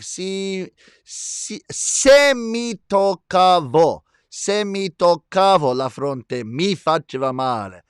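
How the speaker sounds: slow, drawn-out Italian pronunciation; Italian accent. Shown as a demonstration. Host explains verb changes for "I touch my forehead", slow Italian accent. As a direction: demonstrate the Italian slowly, leaning on "mi". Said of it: "That's a good Italian accent".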